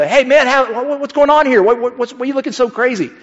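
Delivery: high, startled questions tumbling out; high stammering what's going on; alarmed teen. Retold or imitated. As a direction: stammer like a worried friend. Teen self voiced, alarmed.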